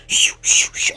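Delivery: whispered shoo shoo like rushing air; shooing hiss. Retold or imitated. Imitating the washroom's noises for viewers, airy whispered shoos.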